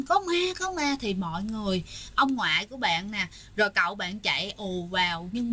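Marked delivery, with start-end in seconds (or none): 0.0-1.0 retold frightened cry. Storyteller retelling mother's cry at seeing ghostly feet, mock panicked cry. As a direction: imitate a panicked call-out without real volume. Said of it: "hoảng hốt và la lên".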